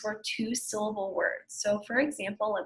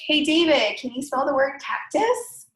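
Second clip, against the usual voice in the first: calling out; 5 semitones higher, 5 dB louder than usual. Retold or imitated. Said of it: "I would be like".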